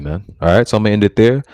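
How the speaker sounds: normal talking voice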